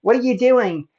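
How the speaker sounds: high, loud indignant challenge; imitating an upset woman confronting someone; upset, retold. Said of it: "she got all upset at us".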